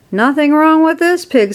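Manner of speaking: high-pitched, upbeat remark; cheerful. A narrator's reading. Farmer shoving pig into crate, cheerful. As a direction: sing out the verdict cheerily. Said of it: "said Mister Zuckerman cheerfully".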